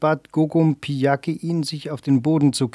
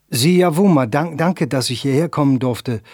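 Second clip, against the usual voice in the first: plain reading voice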